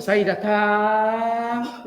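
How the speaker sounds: very slow, elongated Malay words; drawn-out demo. Shown as a demonstration. Lecturer showing how silly stretched Malay sounds, very slow. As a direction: drag each syllable out, tajwid-style. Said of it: "Kalau sebut".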